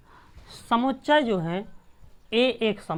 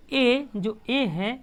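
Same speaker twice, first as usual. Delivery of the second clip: slow reading aloud; 3 semitones higher, much slower than usual. Shown as a demonstration. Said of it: "इसको क्या पढ़ेंगे?".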